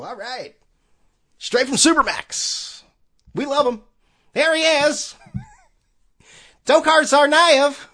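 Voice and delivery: high, loud radio play-by-play voice; Joe Castiglione impression. Podcaster mocking the Red Sox radio announcer, loud and high. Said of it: "You like my Joe Castiglione impersonation?".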